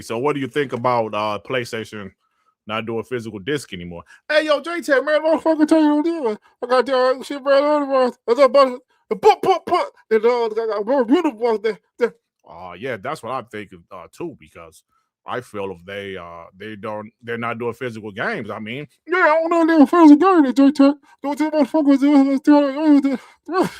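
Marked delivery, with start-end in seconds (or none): 4.3-12.1 high, loud excited put-on voice; loud, pitched-up True Witty rant; True Witty impression. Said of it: "True Witty". 19.1-23.7 high, loud put-on voice; hyped, stammering True Witty; loud, pitched-up True Witty; True Witty impression. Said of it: "True Witty".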